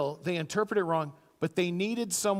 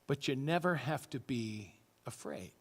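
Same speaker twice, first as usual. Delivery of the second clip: whispered, reassuring; soft whisper; 5 semitones lower, 7 dB quieter than usual. Real, not acted. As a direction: breathe it softly to the congregation. Pastor reassures congregation softly.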